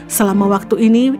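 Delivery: usual speaking voice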